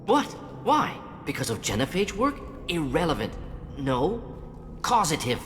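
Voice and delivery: flustered, high-pitched defensiveness; agitated, rattled denial; disturbed. Acted. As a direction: snap back in short, high bursts. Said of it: "didn't expect you to be disturbed".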